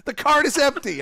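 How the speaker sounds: ordinary delivery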